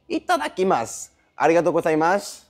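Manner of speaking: lightly put-on Japanese anime voice. Showing off new Japanese vocabulary, lightly put-on Japanese voice. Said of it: "faire les, les voix japonaises".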